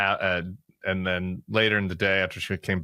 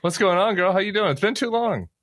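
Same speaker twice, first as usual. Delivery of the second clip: excited greeting; 11 semitones higher, 3 dB louder than usual. Real, not acted. Greeting an old friend warmly.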